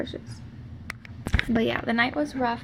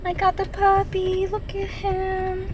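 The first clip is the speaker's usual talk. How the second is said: higher, slow cooing; 8 semitones higher than usual. Real, not acted. Cooing at new puppy.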